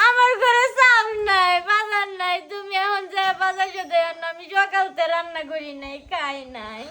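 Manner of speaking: crying. Acted. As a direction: wail your complaint to your husband. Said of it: "কান্দো কে".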